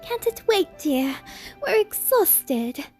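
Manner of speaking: high, slow, weary fancy lady; Rarity, exhausted. Acted. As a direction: sigh it out, tired and prim. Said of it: "said Rarity".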